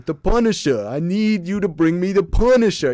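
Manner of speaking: mock New York tough-guy accent as Jigsaw; horrible New York accent. Shown as a demonstration. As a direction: ham up a cartoon New York villain. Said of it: "I'm doing a horrible accent".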